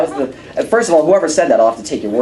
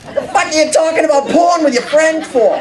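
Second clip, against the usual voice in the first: Boston mom. Retold or imitated. Mother yelling at her son Johnny in a thick Boston accent. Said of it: "real thick Boston accent".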